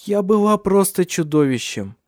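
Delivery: high, wailing self-reproach; tearful remorse. A narrator's reading. Ursula confessing to her sister-in-law, tearful remorse. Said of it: "Слёзы бежали по щекам Урсулы".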